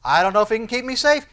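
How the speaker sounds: acted objection with the voice climbing; doubter's protest. Preacher voicing doubt of God's protection, loud protest.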